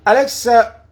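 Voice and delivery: high, loud call. Real, not acted. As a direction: shout for the voice assistant. Calling the assistant.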